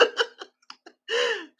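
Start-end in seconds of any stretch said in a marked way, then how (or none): 0.0-0.1 squealing, breaking into high laughter; can barely get the words out for laughing; laughing hard. Real, not acted. Cracking up telling viewers Chrissy blew their Wi-Fi too.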